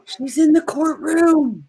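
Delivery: high, loud thrilled squeal, repeating it; excited squeal. Real, not acted. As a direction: gush like you just spotted her. Squealing over courtroom news.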